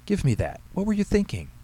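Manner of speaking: high-pitched, alarmed mother; alarmed scolding. A narrator's reading. Mother taking a knife off her son, alarmed scolding. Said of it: "she shouted, running over to him".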